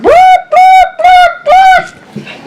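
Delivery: very high, loud honking boops; horn honk. Pretend. Horn sound in picture book.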